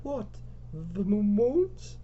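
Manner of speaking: stuttered, high-pitched question; nervous question. Acted. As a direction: stammer it, rising high at the end. Lisa asks, scared by moans.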